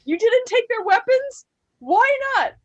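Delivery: high, excited character impression; loud, high, gleeful mimicry; Sarah. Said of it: "She's like".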